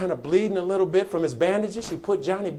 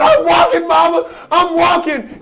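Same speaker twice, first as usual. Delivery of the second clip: very high, loud, exultant shout; triumphant boy's cry; 11 semitones higher, 14 dB louder than usual. Retold or imitated. Johnny answers Mama on his feet, triumphant shout. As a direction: cry out the miracle as the boy.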